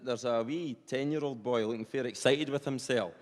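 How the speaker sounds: usual speaking voice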